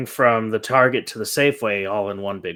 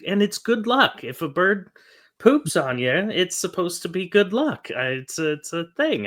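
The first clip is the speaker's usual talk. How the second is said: cheery wife; 8 semitones higher than usual. Retold or imitated. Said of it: "she's like".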